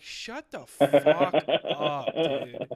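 loud, slow, laughing shout; laughing yell. Retold or imitated. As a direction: bark it out while laughing. Everybody yelling at Hitler.

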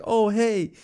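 high-pitched breezy ad imitation; mock casual. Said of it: "trying to make it look normal".

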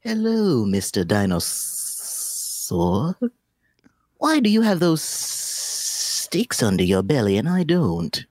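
high, slow, innocent snake voice; a curious little snake asking. Acted. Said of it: "Okay, hold on, hold on".

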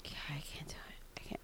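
exhausted, whispered give-up; tired whisper. Real, not acted. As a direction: whisper it, too worn out to go on. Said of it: "I'm so shot right now".

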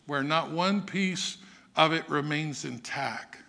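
ordinary talk

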